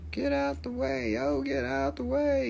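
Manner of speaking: soft, high put-on voice; playful little high-pitched plea; high silly voice. Repeats command in put-on voice.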